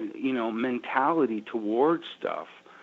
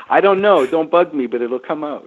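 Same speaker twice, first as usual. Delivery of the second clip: mock grumpy brush-off; 4 semitones higher, 7 dB louder than usual. Retold grumpy reply.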